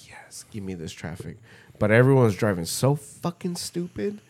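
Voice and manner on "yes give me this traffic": whispered, gleeful aside; whispering delight at the jam; mock whisper. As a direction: hush it like a secret wish. Whispered glee at traffic.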